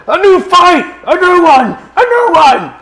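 very high, loud battle cry; wildly eager yelling for a brawl; excited in-character shout. Acted. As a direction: shout it out in a high-pitched frenzy. Player calls out excitedly in character.

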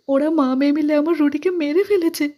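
put-on high, loud crying accusation in a young woman's voice; grieving daughter blaming mother, high crying wail; tearful wail. A narrator's reading. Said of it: "সোনা কেঁদে কেঁদে বলছে".

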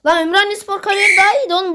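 fast, high, shouted complaint; real frustrated shout; outraged yell. Real, not acted. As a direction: yell it in disbelief. Outraged at a tired player.

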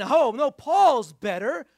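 drawn-out, emphatic acted protest; insistent partisan. Preacher mimicking Corinthians taking sides, insistent. Acting out quarrelling Corinthian factions.

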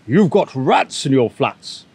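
slow, gleeful pigeon burble; gleeful pigeon spilling news slowly; burbling with glee. A narrator's reading. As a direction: relish the gossip, slow and bubbly. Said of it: "he burbled with glee".

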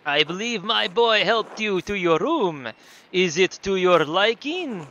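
put-on loud, slow, accented innkeeper; big drawn-out host voice; Nico from GTA IV. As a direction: present the house in a hammed-up foreign accent. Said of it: "do Nico from G T A four".

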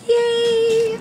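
high, loud squealed yay; real burst of excitement; excited cheer. Real, not acted. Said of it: "I'm so excited".

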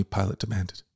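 ordinary delivery

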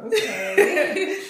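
high, loud laughing okay; a baffled okay, laughing; bemused okay. Retold or imitated. Watching the loud girl pace, a high laughing okay. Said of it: "I'm just like".

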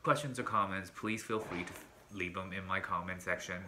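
flat, quiet robot-like read. Real, not acted. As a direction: recite it like a robot, softly. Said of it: "Why do I sound like a robot?".

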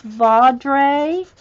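Vaudrey said slowly as vawd-RAY, rising at the end; confirming pronunciation. Real, not acted. Host reading the author's answer, sounding out vawd-RAY. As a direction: stretch Vaudrey out with an -ray ending. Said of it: "Okay, so it is".

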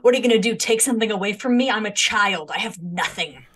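hammed-up cheeky kid daring a parent; mock childish defiance. Player imitating their seven-year-old self daring a parent, mock defiant. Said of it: "when I was seven".

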